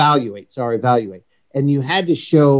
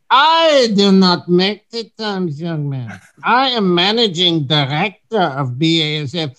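German tourist; 5 semitones higher than usual. Retold or imitated. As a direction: play the indignant German guest who lost his tee time. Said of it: "I was acting like this German".

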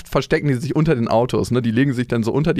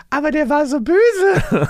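high, drawn-out imitation of a little kid; child's voice; 14 semitones higher, slower than usual. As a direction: protest like a small child, slowly and way up high. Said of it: "ist sie so".

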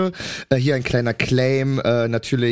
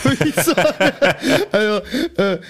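laughing; 7 semitones higher than usual. Real, not acted. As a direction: crack up mid-sentence. Laughs at own story.

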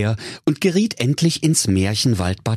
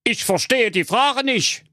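much higher put-on witch voice; old witch. The witch answering Rapunzel, high put-on voice. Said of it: "Da erwiderte die Alte".

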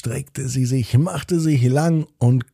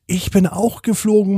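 higher-pitched excited discovery; high, eager me-too; amazed child; 8 semitones higher than usual. A narrator's reading. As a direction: exclaim it as an astonished little girl. Sister amazed at the same dream.

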